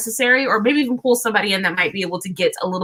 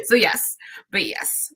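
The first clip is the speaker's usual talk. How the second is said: giggly whisper; 4 dB quieter, slower than usual. Real, not acted. Giggling aside after laugh.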